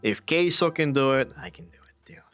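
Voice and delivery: ordinary delivery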